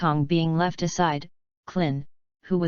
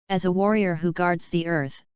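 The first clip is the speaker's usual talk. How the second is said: ordinary delivery, nothing marked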